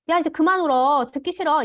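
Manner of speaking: mock exasperated parent shouting; irritated yelling. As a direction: yell at the crying child to stop. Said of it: "이런 표현들을 많이 하시거든요".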